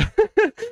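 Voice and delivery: short, high-pitched burst of giggles. Real, not acted. Streamer struggling to answer in English, giggling. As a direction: crack up mid-sentence.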